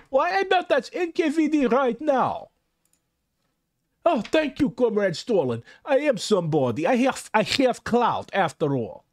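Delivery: high-pitched, hammed-up Russian accent; horrible Russian accent. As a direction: exclaim it in a bad, excitable Russian accent. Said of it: "put on my horrible Russian accent".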